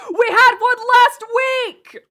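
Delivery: high, loud, slow protesting yell; indignant shout. Real, not acted. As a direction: shout back in mock outrage. Protesting chat's complaint.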